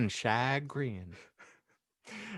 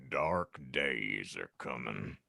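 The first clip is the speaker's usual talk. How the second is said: low, slow, hushed and grave; an ominous warning from Hagrid; 12 semitones lower, 9 dB quieter, slower than usual. Acted. Hagrid warns the table over casserole, low grave voice. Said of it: "Hagrid pronounced gravely".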